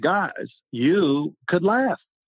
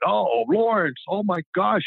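higher-pitched imitated exclamation; teammates' mock reaction; 6 semitones higher, slower than usual. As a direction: tease him like a delighted teammate. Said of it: "the players loved it".

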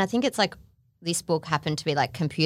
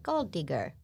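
softer robot voice; 9 dB quieter, slower than usual. Shown as a demonstration. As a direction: say it like a computer, much softer. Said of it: "Does that sound better?".

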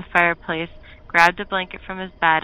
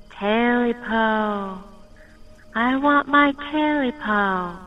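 high, slow, eerie hissing creature voice; otherworldly hiss; 5 semitones higher, much slower than usual. Acted. Creature hissing at Smitty's cabin, slow and eerie. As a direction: drag it out like a ghostly thing calling. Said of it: "otherworldly voice which hissed".

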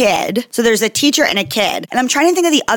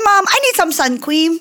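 imitating young daughter; 7 semitones higher than usual. Showing co-host how her daughter says it, little-kid impression. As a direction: mimic a small child saying "cweam" for cream. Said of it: "That's how she says it".

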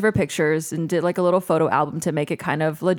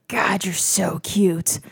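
whispered flirt; much slower than usual. Retold or imitated. Crush's flirty reply quoted.

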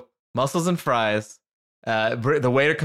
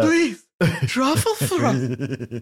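much higher, slow, swooning rapture; ecstatic over truffle fries; 9 semitones higher, much slower than usual. Retold or imitated. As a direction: moan it like the best thing ever. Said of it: "But she was like".

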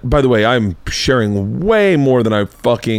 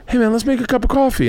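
high, quick little-brain voice; 13 semitones higher, faster than usual. Retold or imitated.

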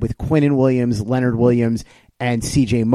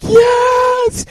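high, loud burst of joy; retold joyful scream; 4 dB louder than usual. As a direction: yell it like your team just got the pick. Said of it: "I was screaming".

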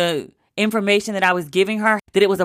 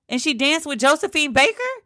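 higher, rising amazed question; incredulous mom; 7 semitones higher than usual. Retold or imitated. Mom hearing about [name], amazed and rising. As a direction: ask it like it's too good to be true.

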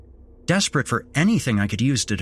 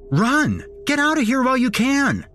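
high-pitched urgent cry; mind screaming; 14 semitones higher than usual. A narrator's reading. Narrator's own mind urging escape, screamed. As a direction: scream a warning to flee. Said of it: "My mind screamed at me".

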